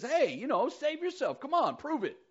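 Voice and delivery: high, loud jeering challenge; mocking dare. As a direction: goad him to prove it. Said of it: "he mocks Jesus".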